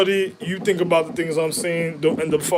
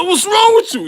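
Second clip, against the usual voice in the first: much higher, louder repeat of the wife's question; wife yelling; 7 dB louder than usual. Retold or imitated. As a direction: shriek it as the exasperated wife. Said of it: "She said".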